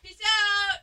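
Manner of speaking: high, light send-off; high playful goodbye; playful sign-off. Real, not acted. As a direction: toss it off high on the way out.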